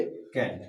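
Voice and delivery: कैंट with a flat American a, like kent; कैंट as American cant, no broad aa; American can't. Shown as a demonstration. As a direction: say कैंट the American way, short front vowel. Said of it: "American में बोलेंगे".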